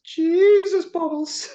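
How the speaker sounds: high, loud incredulous cry; mock exclamation. Captain mocking a kid in goggles, high incredulous cry. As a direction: squeal it in mock disbelief.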